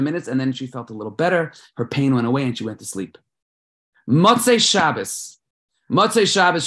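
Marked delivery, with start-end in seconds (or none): 4.1-6.7 dramatic announcement. Real, not acted. Dramatic scene shift announced.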